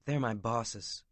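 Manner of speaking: ordinary delivery, nothing marked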